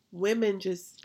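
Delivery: usual speaking voice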